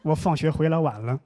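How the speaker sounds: ordinary talk, nothing marked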